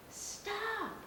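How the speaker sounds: shouted. Acted. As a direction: yell it to halt them. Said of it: "shouted the bridge keeper".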